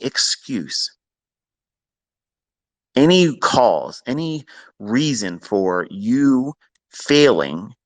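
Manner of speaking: real slow, emphatic lecturing; drawn-out words with deliberate pauses; slow and painful. Shown as a demonstration. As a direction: spell it out painfully slowly. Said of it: "say it again real slow and painfully".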